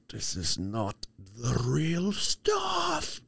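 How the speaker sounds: very slow, drawn-out hammed-up complaint; mock outrage. Complaining about fat-free whipped cream, very slow mock outrage. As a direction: drag each word out in fake dismay.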